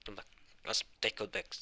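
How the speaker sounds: usual speaking voice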